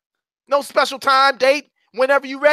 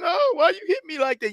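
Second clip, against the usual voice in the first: whiny crying; 11 semitones higher, 4 dB quieter than usual. Acted. Kid tagged with the ball.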